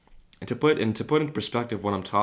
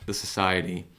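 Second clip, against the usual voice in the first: voice crack. Real, not acted. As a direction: voice cracking on society. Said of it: "Voice crack".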